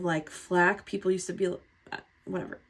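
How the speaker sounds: usual speaking voice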